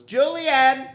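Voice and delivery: high call across a parking lot. Retold or imitated. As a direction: call her son's name. Mom calling out to son.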